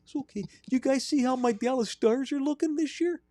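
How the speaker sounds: put-on high-pitched Wisconsin hockey accent; high, sing-song Midwestern creep. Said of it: "I got locked into characters".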